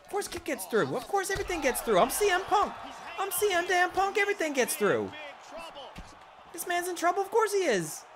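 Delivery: triumphant gamer shouting; hyped yelling. Real, not acted. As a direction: yell it out, fully hyped. Celebrating a win, yelling.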